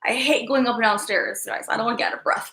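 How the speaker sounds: ordinary talk, nothing marked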